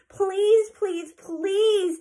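high, pleading please, please, please; begging. A narrator's reading. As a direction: beg like excited little girls. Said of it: "they begged Eudora".

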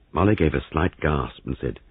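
plain reading voice

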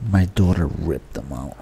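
low, soft and slow admission; grimly understated. Real, not acted. Dad telling co-host about the broken mouse, grimly understated. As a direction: let it sink, slow and low. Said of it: "The way you said it, it sounded like".

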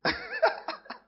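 a high burst of laughter at his own joke. Real, not acted. Preacher laughing at his own malaria joke, high laugh.

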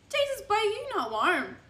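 high-pitched incredulous exclamation; high, teasing disbelief; mock incredulous. Acted exclamation at overdressed customer.

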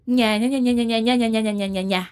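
sing-song nya-nya mimicry; mocking gibberish. Big sister mocking her student brother's excuses, taunting. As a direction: chatter it mockingly in one unbroken run.

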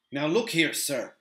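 firm challenge; mustered courage. Acted. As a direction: stand up to the captain bravely. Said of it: "with all the courage".

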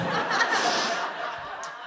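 a high airless burst of laughter; breathy laugh. Real, not acted. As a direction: laugh helplessly at the story. Laughs at own story.

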